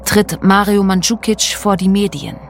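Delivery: ordinary delivery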